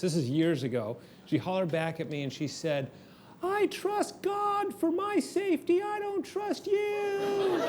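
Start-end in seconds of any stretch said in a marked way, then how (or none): 3.4-7.6 very high, slow mock holler of the wife; high-pitched voice. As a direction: imitate her shrill, pious holler, slowly. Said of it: "in this really high-pitched voice".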